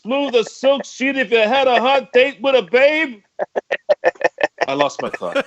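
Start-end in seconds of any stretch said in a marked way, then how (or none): 0.0-3.2 Stiller impression. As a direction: yell it like a fed-up old father. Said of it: "That I gotta do for Mister Stiller?".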